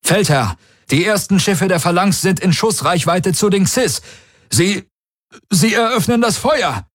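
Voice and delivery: high, urgent officer's alarm; tactical officer reporting. A narrator's reading. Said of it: "Daraios taktischer Offizier vermeldete". (aufgeregte Meldung mit hoher Stimme)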